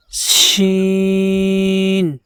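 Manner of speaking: "شین" held very long with a hissing sh; whistling sh. Shown as a demonstration. Teacher showing the whistle: a long "شین". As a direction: draw the sh of "شین" out slowly. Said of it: "سیٹی کی آواز نکالی جائے گی".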